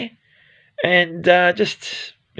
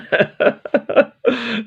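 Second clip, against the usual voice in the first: laugh; 9 semitones higher than usual. Real, not acted. Laughs at his own swearing.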